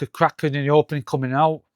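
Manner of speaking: usual speaking voice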